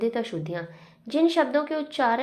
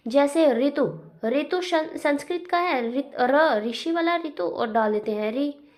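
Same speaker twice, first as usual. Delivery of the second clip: usual speaking voice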